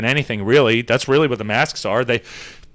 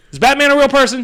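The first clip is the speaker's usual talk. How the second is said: incredulous; 10 semitones higher, faster than usual. Real, not acted. Scene: mocking a man's Batman reply, incredulous high. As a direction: voice jumps high in disbelief.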